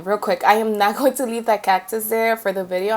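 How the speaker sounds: ordinary delivery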